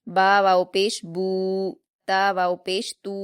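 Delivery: ऊ stretched over two counts, slow and clear; teacher models the two-count u; drawn-out drill. Shown as a demonstration. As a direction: model the letter and vowel slowly for pupils. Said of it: "दो हरकात खींचेंगे".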